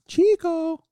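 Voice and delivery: high, loud call across the room. Pretend. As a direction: shout the name to someone far away. Calling out for missing Bob.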